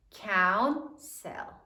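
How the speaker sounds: "Counsel" blended as coun... sell; syllable by syllable. Shown as a demonstration. Teacher blends sounded-out counsel, syllable by syllable. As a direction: say "Counsel" in two slow beats with a full E. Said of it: "Sound it out".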